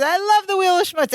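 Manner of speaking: high-pitched excited gushing; Balky. Retold or imitated. Balky interrupting before Kelly can describe the stunt, excited. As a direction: imitate bursting with delight at a favourite thing. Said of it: "Balky gets all excited".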